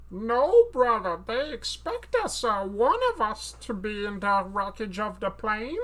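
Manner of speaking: high, slow, theatrical villain; Jar Jar Binks as Bane. Acted. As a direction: declaim grandly in a silly alien voice. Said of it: "Jar Jar Binks, but it's actually Bane".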